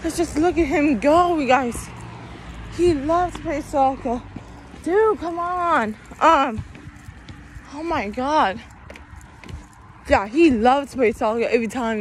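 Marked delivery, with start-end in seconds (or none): 4.8-6.0 drawn-out, higher-pitched complaint; slow, pleading "come on" whine; exasperated. Real, not acted. Walker nagging a dawdling dog, exasperated. Scolding a dog that keeps stopping.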